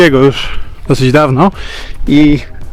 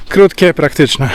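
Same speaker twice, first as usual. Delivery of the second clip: normal talking voice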